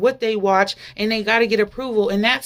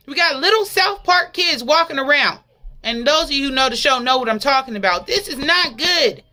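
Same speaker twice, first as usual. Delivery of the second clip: fervent preaching, raised and pressing; 7 semitones higher, 4 dB louder than usual. Real, not acted. Preacher warning the congregation, fervent preaching. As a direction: drive the message home to the church.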